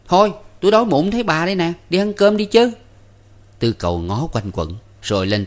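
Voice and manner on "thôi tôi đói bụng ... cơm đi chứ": put-on high, loud, quick boyish clamour; loud outcry. A narrator's reading. Năm suddenly clamours to go and eat, crying out. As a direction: burst out loudly, fast and high. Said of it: "kêu rầm lên".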